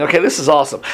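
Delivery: ordinary delivery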